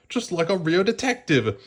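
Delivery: high, eager little voice; excited puppy. A narrator's reading. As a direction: burst out with excitement. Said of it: "Lucky exclaimed".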